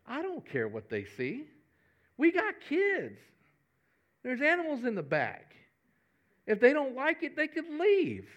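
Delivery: wife says she doesn't care what guests see, mock high voice. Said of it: "my wife's the type".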